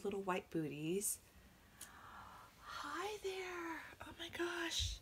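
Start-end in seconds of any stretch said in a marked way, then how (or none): 2.6-5.0 tender cooing. Real, not acted. Greeting the doll again, tenderly.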